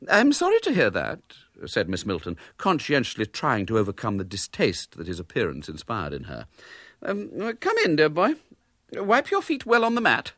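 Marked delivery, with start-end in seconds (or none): 0.1-1.2 high, prim lady's voice; a polite spinster forcing kindness. A narrator's reading. Miss Milton to the disguised boy, high and prim. 7.0-10.3 high, prim lady's voice; a polite spinster forcing kindness. A narrator's reading. Miss Milton to the disguised boy, high and prim.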